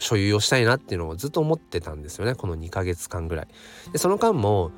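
normal talking voice

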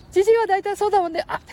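put-on high squeaky boy voice; Ken-chan voice.

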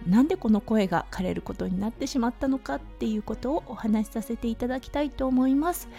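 ordinary delivery

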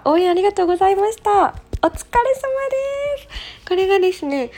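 high, loud cheerful burst; bright congratulation. Real, not acted. Streamer congratulating a listener on finished exams, excited. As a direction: cheer them on for getting through it.

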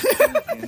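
high burst of laughter. Real, not acted. Laughing at the joke.

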